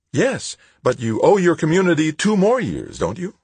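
high, loud lilting woman's voice; sing-song. A narrator's reading. Woman official to Tavalera without looking up, sing-song. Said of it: "she sing-songed".

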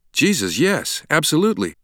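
high-pitched, agitated reply; wild urgency. A narrator's reading. Stranger reacts to news of Venice message, agitated. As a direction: seize on it urgently. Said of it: "The man's eyes were wild now".

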